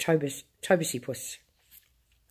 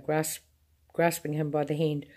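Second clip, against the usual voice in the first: ordinary delivery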